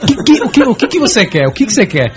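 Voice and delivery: stammered, exasperated asking; flustered questioning. Acted. Gamer to signalling wife, flustered questioning. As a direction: fire off flustered questions, starting high. Acting out his puzzled, flustered reply.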